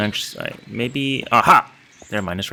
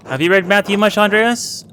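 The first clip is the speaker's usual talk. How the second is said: high, louder questioning monk voice; voiced abbot; 9 semitones higher, 3 dB louder than usual. The abbot quizzes the young scribe at supper, higher voice. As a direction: ask it as a probing elder churchman. Voicing a senior monk's question.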